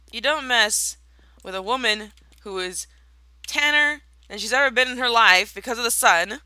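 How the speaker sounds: loud, high indignant rant; mock outraged rant. Tired gamer ranting at pranksters, mock outrage.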